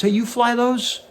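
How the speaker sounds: put-on high, wide-eyed young guy's question; eager young cable guy. Cable guy asks the pilot about the jets, high and eager. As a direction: play the awed cable guy spotting the pictures. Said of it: "he looks up at that wall and he says".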